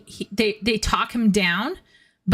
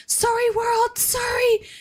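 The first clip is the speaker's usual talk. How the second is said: very high squealing anguished wail; hammed-up anime meltdown; spastic wailing apology. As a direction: freak out and wail your apology to the world. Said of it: "And he's like".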